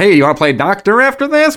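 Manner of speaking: leering cartoon stork; Vlasic stork voice. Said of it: "I just keep saying it like that".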